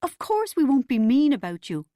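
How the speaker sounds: high, anxious reassurance; quavering. A narrator's reading. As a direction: protest with a quaver. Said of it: "Mum quavered".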